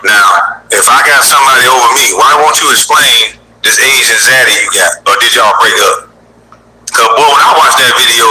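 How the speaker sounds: normal talking voice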